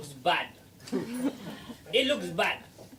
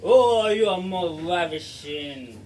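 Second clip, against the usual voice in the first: lovestruck swooning; 4 dB louder, slower than usual. Acted. Love potion swooning at Gladfly.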